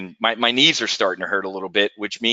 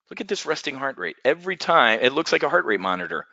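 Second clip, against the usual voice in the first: ordinary delivery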